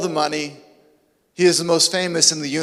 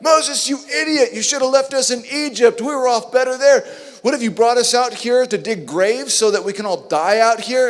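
panicked complaint; 6 semitones higher than usual. Acted. Israelites panicking at the Red Sea, shouting at Moses. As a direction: yell at Moses in panic, much higher. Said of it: "They're like".

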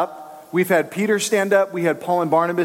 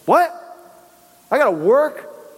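mock dismay; 7 semitones higher than usual. Listener balks at works.

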